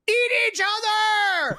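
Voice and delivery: high, slow yelled phrase; shouting capitalized words. Acted. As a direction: yell it out slowly. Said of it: "When it's all caps, I have to yell".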